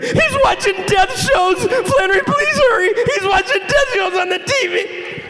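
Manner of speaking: very high, shouted mock panic; very high desperate plea; begging in terror. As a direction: wail it, near crying. Friend pleading in terror.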